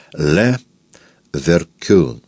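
"Ле Веркюн" split after "Ле", final "кюн" stressed; stress on third syllable. Shown as a demonstration. Narrator shows how the visitor misstresses the surname, "Ле Веркюн" with stressed "кюн". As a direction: break "Ле Веркюн" French-style, landing on "кюн". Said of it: "ставя ударение на третьем слоге".

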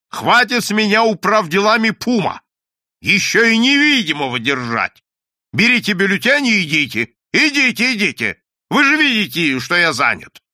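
loud, high-pitched scolding; exasperated boss laying down the law; angry dismissal. A narrator's reading. Boss berates and dismisses the clerk.

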